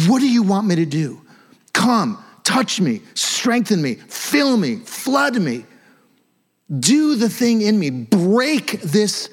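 high, pleading, intense plea; fervent pleading prayer. Shown as a demonstration. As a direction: beg God to break your hard heart. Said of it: "you don't have to yell".

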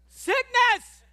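high, loud shouted word; thrilled yell of the word; gleeful shout. Acted. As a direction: cheer the word like good news. Paul voiced rejoicing in sickness.

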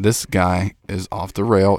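ordinary talk

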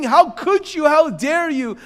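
very high, loud accusing yell; screaming at God. Acted. Man in his hard moments to God, screaming and yelling. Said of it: "screaming and yelling at God".

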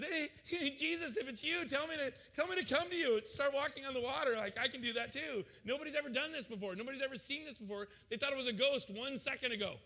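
high, excited, foolish eager voice; eager Peter. Retold or imitated. Peter blurts his request to Jesus, high eager voice. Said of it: "Peter just foolishly says".

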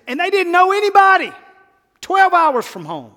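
high, loud preaching cry; preacher shout. Real, not acted. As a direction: lift the whole room with it. Preacher's emphatic climax.

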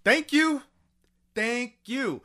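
high-pitched emphatic thank-you; vindicated thanks. Real, not acted. Host thanking co-host for agreeing, high and vindicated.

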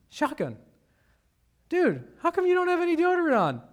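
put-on high, fast, incredulous young guy's question. To his country roommate Shotgun, a fast, high, incredulous question. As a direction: blurt it out in disbelief, fast and high. Asking smelly dorm mate about deodorant.

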